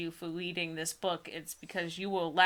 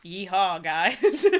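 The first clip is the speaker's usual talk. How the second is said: louder mock cheer to the viewers; sarcastic yeehaw; 2 semitones lower, 7 dB louder, much slower than usual. Real, not acted. Telling viewers about sharing a bad book, mock cheer. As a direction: fake enthusiasm, tongue in cheek.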